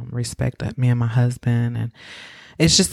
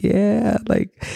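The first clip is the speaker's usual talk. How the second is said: high-pitched imitation of a teary yes; retold teary squeak; mock tearful; 9 semitones higher than usual. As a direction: answer as if starting to cry, half acted. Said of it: "tears just start falling".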